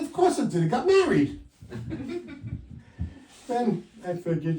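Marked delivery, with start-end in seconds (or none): 0.0-1.4 punchline. Retold or imitated. Punchline to wife.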